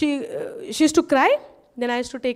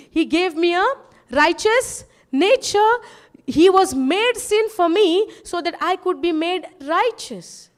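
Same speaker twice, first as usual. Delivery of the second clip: raised, insistent sermon voice; preaching; 4 semitones higher, 3 dB louder than usual. Real, not acted. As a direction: drive the point home to the congregation. Preaching to the congregation.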